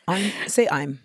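usual speaking voice

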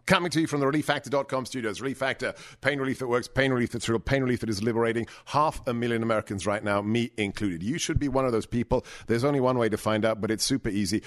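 brisk, salesy pitch for a sponsor; radio ad read. Acted. Host reads a sponsor ad to listeners, in a confident salesy tone. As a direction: sell it like a radio spot.

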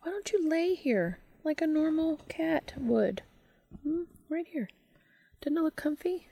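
high soft coaxing voice; coaxing the cat. Real, not acted. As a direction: gently coax the cat.

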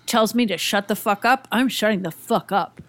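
ordinary talk

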